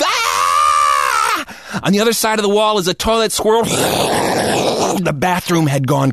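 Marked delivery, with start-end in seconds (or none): 0.0-1.8 low, hammed-up falling scream; boy screaming as he falls in; mock scream. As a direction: yelp as you slip into the toilet. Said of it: "I screamed".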